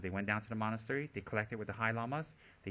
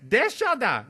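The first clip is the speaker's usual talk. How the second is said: put-on child calling; 13 semitones higher, 15 dB louder, slower than usual. Child calling him over.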